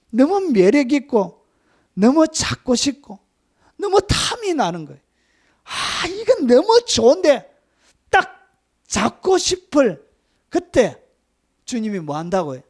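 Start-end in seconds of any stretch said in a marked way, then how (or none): 0.1-11.0 high, loud, slow and yearning; drawn out like something you crave; tempted longing. Acted. Preacher to congregation, voicing the pull of temptation, high and longing.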